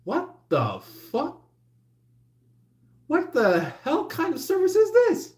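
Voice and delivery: exaggerated fed-up shriek; mock outraged customer. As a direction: blow up at bad service, mock-furious. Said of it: "you're just like".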